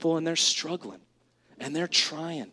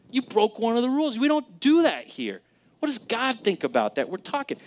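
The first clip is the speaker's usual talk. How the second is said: raised, higher scolding of a son; stern father; 7 semitones higher than usual. Retold or imitated. Father confronting his son over broken rules, stern and raised. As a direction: lay down the law to the boy.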